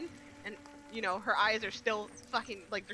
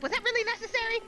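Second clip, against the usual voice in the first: exasperated; 13 semitones higher, 5 dB louder, faster than usual. Acted. Character scolds a companion.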